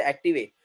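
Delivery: "active A" run together as "acti-vay"; mispronounced "active A", ending in "ay"; wrong final E. Shown as a demonstration. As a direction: voice the silent E of active, "active A" as one word. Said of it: "We don't say".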